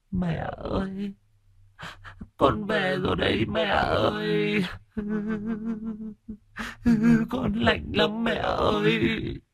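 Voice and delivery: very high, soft and slow ghost voice; a cold drowned child calling for mother; ghostly son's voice. Acted. Drowned son's ghost calling to his mother, high and slow.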